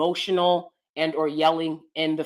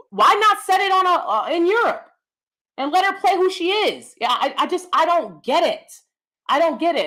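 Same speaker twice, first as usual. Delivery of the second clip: high-pitched, louder burst of real frustration; exasperated; 10 semitones higher, 4 dB louder than usual. Real, not acted. Actor objecting to the casting of a film, exasperated. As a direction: protest in baffled exasperation, pitch riding high.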